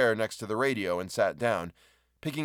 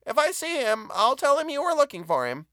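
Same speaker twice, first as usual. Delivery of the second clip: high, raised call down a stairwell; shouting down stairs; 4 dB louder than usual. A narrator's reading. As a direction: call after the visitor going downstairs. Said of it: "Missus Royster shouted down the stairwell".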